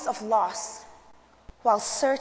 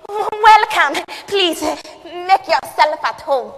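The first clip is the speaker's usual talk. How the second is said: loud, high, effusive hostess; imitating a mother's big welcome; welcoming mother; 6 semitones higher, 8 dB louder, much slower than usual. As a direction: greet a guest grandly, slowing at the end. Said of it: "What would my mother say?".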